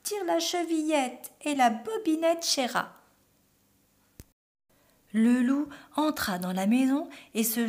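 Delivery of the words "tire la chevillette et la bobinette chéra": high, raised granny voice; grandmother calling out. A narrator's reading. Grandmother to her visitor, calling out high. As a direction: call through the door as granny. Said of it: "la grand-mère cria".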